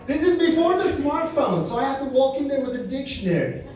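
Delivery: normal talking voice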